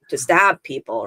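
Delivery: usual speaking voice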